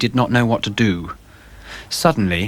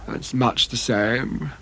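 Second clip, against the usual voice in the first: weary murmur; 6 dB quieter, slower than usual. Acted. Adrian's weary reply.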